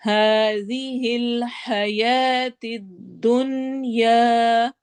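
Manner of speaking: slow tajweed recitation, الدنیا with long ya; Quran recitation. Shown as a demonstration. Tajweed teacher demonstrating for students, slow recitation. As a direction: recite slowly, no nasal sound in الدنیا. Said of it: "یا کی sound نہیں ہونی چاہیے".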